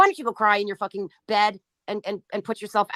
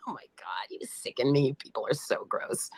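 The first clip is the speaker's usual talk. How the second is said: disgusted whisper, much softer; 7 semitones lower, 6 dB quieter than usual. Real, not acted. Streamer mutters disgust at chatters in a whisper. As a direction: hiss it under your breath in disgust. Disgusted aside after a laugh.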